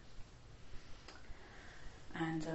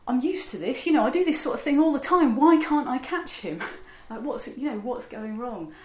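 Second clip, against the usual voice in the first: much higher and louder, fast exasperated ranting; frustrated outburst; 6 semitones higher, 13 dB louder than usual. Acted. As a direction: blurt it out like a bandit who can't catch his prey. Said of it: "you can just imagine his frustration".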